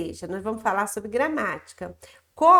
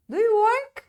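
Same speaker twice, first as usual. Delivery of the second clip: higher, louder questioning voice; teacher playing the one who asks, higher and louder; acted question; 9 semitones higher, 5 dB louder than usual. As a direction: ask it brightly up high, like the other speaker. Teacher acts both dialogue parts.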